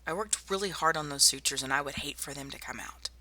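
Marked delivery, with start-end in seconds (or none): 1.6-3.1 whispering. Acted. Teasing the patient about sutures.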